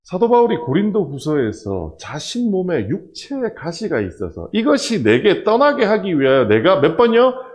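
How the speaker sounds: usual speaking voice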